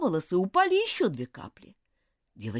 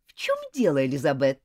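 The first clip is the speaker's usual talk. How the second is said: irritated; 6 semitones lower, 3 dB louder than usual. A narrator's reading. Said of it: "раздражённо спросила учительница".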